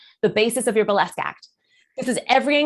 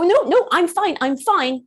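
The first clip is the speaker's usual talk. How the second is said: raised, insistent brush-off; tense denial; 6 semitones higher, 4 dB louder, slower than usual. Acted. Coach showing someone quashing nerves, mock-tense denial. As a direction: insist loudly, pretending all is well. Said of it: "You're not gonna go".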